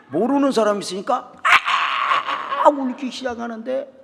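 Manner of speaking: lively comic telling. Real, not acted. Comic story of crying kids.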